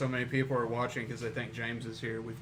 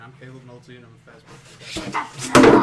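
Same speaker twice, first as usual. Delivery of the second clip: mock loud status; 18 dB louder than usual. Mock Facebook status read-out.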